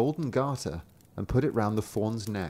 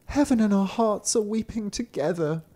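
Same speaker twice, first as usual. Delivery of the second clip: little sister's voice; 10 semitones higher than usual. A narrator's reading. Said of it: "the little sister said".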